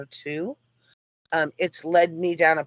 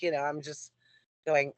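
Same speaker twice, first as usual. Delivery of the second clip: ordinary talk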